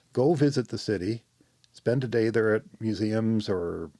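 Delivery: ordinary delivery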